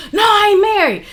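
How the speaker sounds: high-pitched imitation of a lying man; indignant denial. Boyfriend swearing to her he isn't married, mock indignant. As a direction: mimic his protest, pitched well up.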